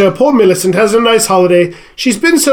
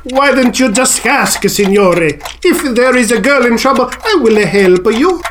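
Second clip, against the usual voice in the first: kindly Italian woodcarver; 4 semitones higher than usual. Acted. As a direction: offer help gently as an Italian craftsman. Woodcarver softens, offers help.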